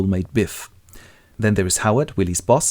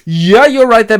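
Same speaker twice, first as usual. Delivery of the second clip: loud, high drawn-in agreement; acted placating; 12 semitones higher, 10 dB louder than usual. Howard brushes off Willie after the sacking, placating. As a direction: humour him loudly before the but.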